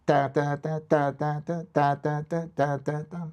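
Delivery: ta ta ta with some beats punched; a beatboxed accent sequence; accented ta rhythm. Shown as a demonstration. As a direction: sing the accent pattern with your mouth. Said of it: "then you can say full, half, no".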